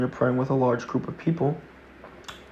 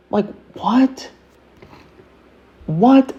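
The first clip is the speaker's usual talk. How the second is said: incredulous; 7 semitones higher, much slower than usual. Acted. Mocking his own excitement.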